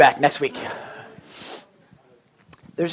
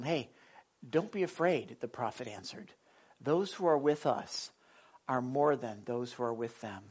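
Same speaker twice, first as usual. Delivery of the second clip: softer, steady, reassuring; calm prophet; 6 semitones lower, 12 dB quieter than usual. Retold or imitated. Prophet comforting his frightened servant, softly reassuring. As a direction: gently settle his fear. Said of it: "the prophet said to him".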